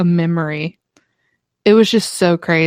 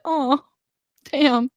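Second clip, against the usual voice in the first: higher, softer let-down sigh; mock disappointment; 6 semitones higher, 5 dB quieter than usual. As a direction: deflate in a playful way. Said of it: "But I would be like".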